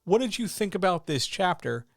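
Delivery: vague writer question. Retold or imitated. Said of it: "is not feedback".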